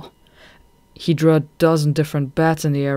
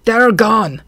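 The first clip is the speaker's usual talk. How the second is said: shout; 7 semitones higher, 9 dB louder than usual. Acted. Goth finds the pups gone.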